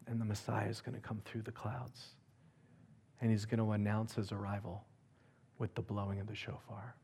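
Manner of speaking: very soft, low-key retelling; hushed vision. Retold or imitated. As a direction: let it sink almost to a murmur. Retelling wife's vision in hush.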